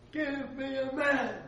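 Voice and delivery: slow, high, booming challenge; Goliath's yell. Retold or imitated. Guide retelling how he played Goliath for a tour, slow booming yell. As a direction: hurl the challenge across the valley. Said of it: "where Goliath was gonna yell it out".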